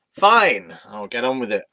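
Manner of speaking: an exasperated, theatrical "fine"; mock exasperated. Told to get on with it, mock exasperated. Mock giving in to being hurried.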